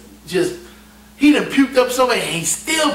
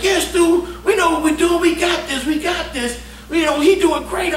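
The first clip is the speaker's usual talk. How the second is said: excited insisting; 9 semitones higher than usual. Retold or imitated. Drunk chief insisting to narrator.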